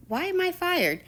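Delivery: high-pitched incredulous question; mock-shocked teacher, voice jumping up; retold disbelief. Teacher asking her pupil why she's fired, retold disbelief.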